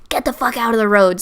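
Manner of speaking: raspy copy of the passenger; mimicked smoker voice. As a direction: throw back the smoker's raspy cadence. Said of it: "Same cadence".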